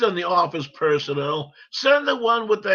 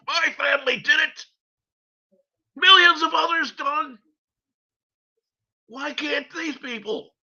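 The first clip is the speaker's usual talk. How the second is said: angry shout; 8 semitones higher than usual. Real, not acted. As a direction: shout it, outraged. Angry rant.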